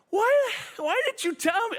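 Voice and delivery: high, slow, mock-mortified wail; imitating his wife as she realizes the theft, mortified and high; aghast. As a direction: imitate her horrified disbelief. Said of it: "and she says".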